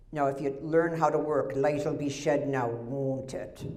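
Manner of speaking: put-on grousing old Scot in a thick Scottish accent. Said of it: "it must have been my Scottish accent".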